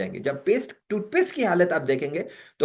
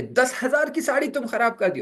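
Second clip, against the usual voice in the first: high, changed scolding voice; husband scolding wife about the ten-thousand sari; 9 semitones higher than usual. Retold or imitated. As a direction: rebuke her over the ruined sari. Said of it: "ये डांट रहा है कि".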